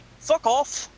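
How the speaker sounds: put-on high-pitched Scottish-accented heckle. Imitating a Scottish comedian's put-down, in a high Scottish accent. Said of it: "a bit with an accent".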